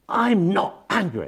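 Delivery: slow, high, imitated angry yell; angry shouting. Patient denying anger to therapist, imitated angry shout. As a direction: mimic an emphatic, drawn-out angry denial. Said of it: "you're still shouting".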